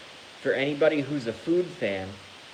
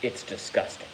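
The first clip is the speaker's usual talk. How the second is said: half-whispered, softer disgust; 5 dB quieter than usual. Pretend. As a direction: hiss out your revulsion. Disgust at tomato chowder.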